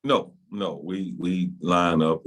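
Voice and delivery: normal talking voice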